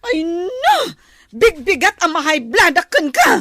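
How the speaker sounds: loud, whining complaint; exasperated outburst. Acted. Passenger snaps at fussy car owner, exasperated. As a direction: complain at full volume, thoroughly fed up.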